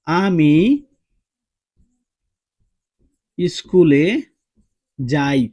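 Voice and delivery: slow and loud, word by word, ending on যাই; slow example sentence. Shown as a demonstration. Teacher dictating an example to students, slow and loud. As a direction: lay out আমি স্কুলে যাই in slow separate words. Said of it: "যেমন দেখো".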